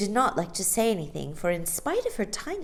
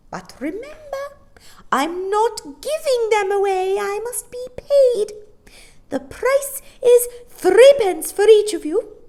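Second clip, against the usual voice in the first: very high, louder shrill warning; serious old woman; 10 dB louder than usual. A narrator's reading. Old shopkeeper telling the children the price. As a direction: insist on being paid.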